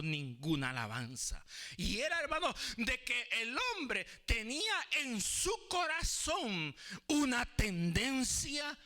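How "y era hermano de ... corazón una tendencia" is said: high, loud-edged pulpit emphasis; forceful preaching. Real, not acted. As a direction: drive each phrase home like a preacher.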